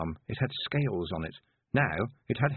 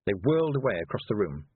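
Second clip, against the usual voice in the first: ordinary delivery, nothing marked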